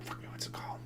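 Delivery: whispered to self; whispering. Real, not acted. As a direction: mutter it in a whisper. Searching for a game name.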